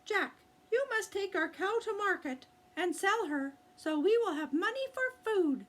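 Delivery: high, fast motherly urging; mother urging her son to sell the cow; mother's voice. Acted. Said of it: "Jack's mother said".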